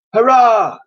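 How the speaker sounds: loud, high crowd cheer. Acted. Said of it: "cheered the crowds".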